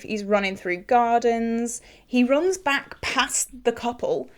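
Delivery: ordinary talk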